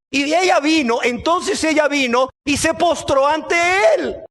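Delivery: ordinary delivery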